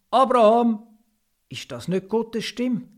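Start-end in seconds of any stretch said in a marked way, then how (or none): 0.1-0.9 a second call, louder and higher, ringing. Acted. God calls Abraham again from afar, louder and ringing. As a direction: call his name out strongly a second time.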